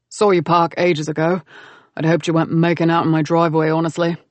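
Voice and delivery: gruff brother-in-law, deadpan; lightly voiced Cameron. Greeting the couple at his door, mildly gruff and dry.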